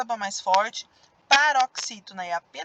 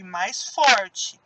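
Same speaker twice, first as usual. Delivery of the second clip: usual speaking voice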